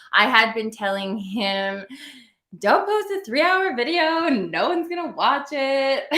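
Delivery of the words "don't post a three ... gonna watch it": higher-pitched mock admonishing; retold warning. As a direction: replay your warning in a high, insistent voice. Retelling her own warning to him.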